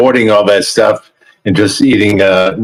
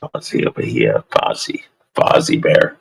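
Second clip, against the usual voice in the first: low hushed coo, much softer; cooing quietly; 12 semitones lower, 6 dB quieter than usual. Real, not acted. Friend cooing over the dog's photo, low and hushed. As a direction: breathe the name like cooing to a pet.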